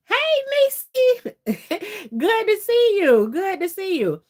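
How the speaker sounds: high, loud, delighted hello; excited greeting. Real, not acted. Streamer welcoming Nisi into the live chat, real excited greeting. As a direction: call out happily to a friend, pitch way up.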